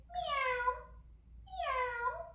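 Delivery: high-pitched drawn-out meows; cat meow. Shown as a demonstration. Yoga teacher leading children in cat pose, high cat meows. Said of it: "do it with me and say".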